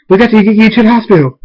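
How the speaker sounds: fast, high, loud alarm; panicked urgency. Acted. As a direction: rush it out in a panic. Panicking at waters breaking.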